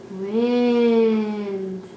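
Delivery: a long, slow, even "Wind"; drawn-out word. Shown as a demonstration. Modelling a word for child.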